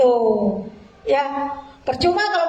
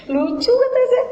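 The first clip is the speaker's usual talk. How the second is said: laughing; 9 semitones higher than usual. Real, not acted. Laughing at a surprising idea.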